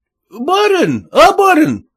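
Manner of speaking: high peasant plea to the master; blacksmith calling out. Acted. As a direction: call up high to the master for double pay. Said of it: "кричал кузнец Калистрат".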